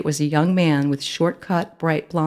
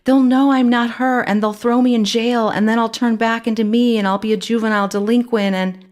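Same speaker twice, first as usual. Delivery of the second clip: rising panic, words tumbling out; panicked rambling; 8 semitones higher, 4 dB louder than usual. A narrator's reading. Girl fearing jail at a traffic stop, rushed panic. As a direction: spiral faster into worry.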